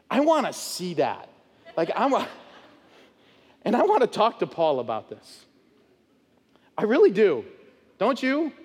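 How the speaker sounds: ordinary talk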